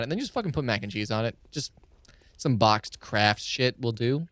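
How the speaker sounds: ordinary talk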